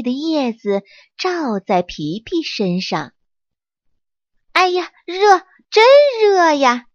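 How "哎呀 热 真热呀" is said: high, loud cry of a little monkey baking in the sun. Acted. Little monkey Pipi complaining loudly about the heat. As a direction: yelp it out, too hot to bear. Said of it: "皮皮大叫着".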